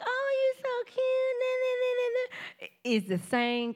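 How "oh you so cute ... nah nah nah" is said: sweeten it up like a fake friend. Former plotter mocking her with a high, sugary voice. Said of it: "the same person be like".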